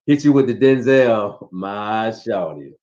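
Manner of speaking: low, slow, smooth Denzel drawl. Retold or imitated. Husband imitating a smooth player talking to his wife. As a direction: lay on the smooth charm. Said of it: "O G will probably be like".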